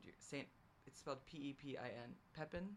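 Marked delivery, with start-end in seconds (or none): 2.4-2.7 Pepin as PEP-in, voice rising; Pepin without French accent. Shown as a demonstration. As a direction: say Pepin the English way, PEP-in, questioningly. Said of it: "without a French accent".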